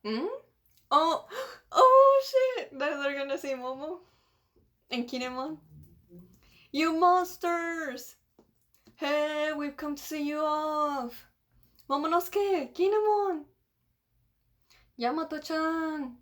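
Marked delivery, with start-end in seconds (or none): 1.8-2.8 high, loud drawn-out wail; real startled outcry; shocked outburst. Real, not acted. Real reaction to the manga page. 6.7-8.2 high, long-drawn shout; slow acted yell; calling out. Reads characters calling out. 8.9-16.2 high, drawn-out calls; stretched names, pitched up high; calling out. Acted. Voices characters calling from afar.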